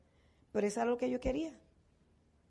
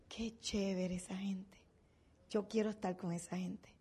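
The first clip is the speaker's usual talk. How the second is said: soft hushed admiration, as if overheard; admiring whisper; 3 semitones lower, 10 dB quieter than usual. Retold or imitated. La gente susurrando con admiración, en voz muy baja. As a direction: whisper it like people admiring you after you leave. People praising you after visit.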